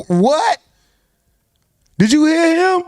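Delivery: high-pitched, rising incredulous question; stunned disbelief. Real, not acted. Stunned reaction to the clip.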